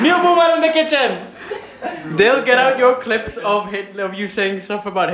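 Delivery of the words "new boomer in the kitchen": high, fast, laughing call. Real, not acted. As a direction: announce it through laughter.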